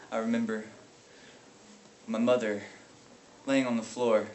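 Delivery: low, soft, halting memory; grieving recollection. Acted. Brother remembering his mother, subdued grieving. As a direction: recall it quietly, with heavy pauses. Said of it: "grieving over an unbearable past".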